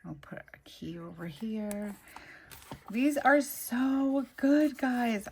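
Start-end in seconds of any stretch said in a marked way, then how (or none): 2.9-5.3 high, loud, drawn-out delight; excited gushing. Real, not acted. Crafter showing viewers the stickers she loves, excited gushing. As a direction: gush slowly, pitched way up.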